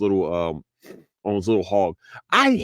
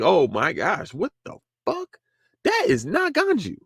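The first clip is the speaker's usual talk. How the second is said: shocked disbelief; 7 semitones higher than usual. Retold or imitated. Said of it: "I was like".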